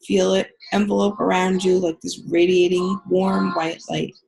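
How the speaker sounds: calm guided healing meditation. Acted. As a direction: ease them into the visualization. Leads a guided visualization.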